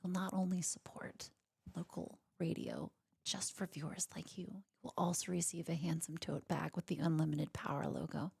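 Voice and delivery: whispered pledge-drive pitch; hushed, intimate radio fundraiser read; public radio host. Pretend. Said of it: "my public radio voice".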